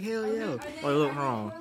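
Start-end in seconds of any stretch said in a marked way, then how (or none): 0.0-0.4 excited. Retold or imitated. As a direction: squeal it out like you spotted something. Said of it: "I'm gonna be like".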